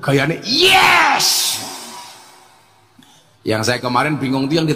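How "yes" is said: high, loud exclaimed yes; mocking an eager convert, loud and high. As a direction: shout yes with glee. Imitates convert's eager reply.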